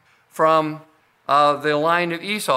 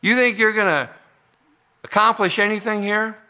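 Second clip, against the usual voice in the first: scoffing God; 6 semitones higher than usual. Acted. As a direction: scoff at the rulers from the throne. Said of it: "he scoffs at them".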